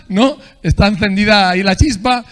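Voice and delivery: usual speaking voice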